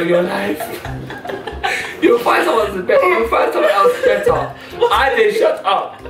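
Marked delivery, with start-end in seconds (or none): none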